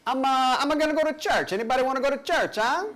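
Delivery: put-on high, fast Italian-accented English; Italian man speaking English. Italian guy inviting hostel strangers to church in an Italian accent. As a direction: ham up an Italian man's English. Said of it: "That's my impression of an Italian man speaking English".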